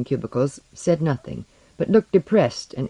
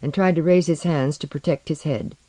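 usual speaking voice

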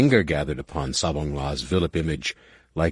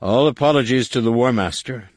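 smooth; 7 semitones higher, 5 dB louder than usual. Acted. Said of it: "Numenor said smoothly".

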